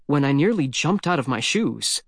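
ordinary delivery, nothing marked

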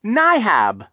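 put-on high, loud baby shriek. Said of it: "Sonny shrieked".

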